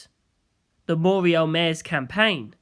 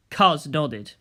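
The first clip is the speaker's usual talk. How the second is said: usual speaking voice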